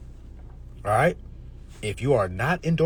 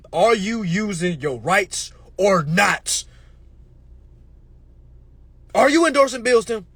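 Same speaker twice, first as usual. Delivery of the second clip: demanding; 3 semitones higher, 5 dB louder, slower than usual. Acted. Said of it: "Fuck all that other shit".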